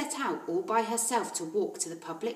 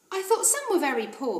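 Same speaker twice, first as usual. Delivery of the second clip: put-on little girl; 6 semitones higher, 4 dB louder than usual. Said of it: "Matilda said".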